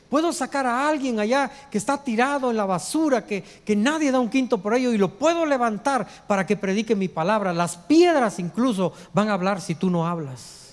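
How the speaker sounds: high-pitched, insistent sermon delivery; driving, emphatic pulpit voice; impassioned preaching. Real, not acted.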